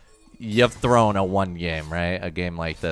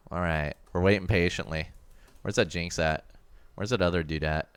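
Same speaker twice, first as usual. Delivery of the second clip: ordinary talk, nothing marked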